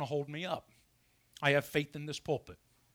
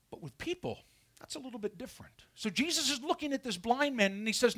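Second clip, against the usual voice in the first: ordinary talk, nothing marked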